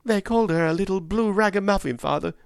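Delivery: put-on high young boy, indignant; a boy rushing in to tell his father; boy's voice. Young Harry speaks to his father.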